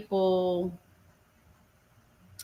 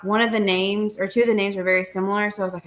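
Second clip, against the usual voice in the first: ordinary talk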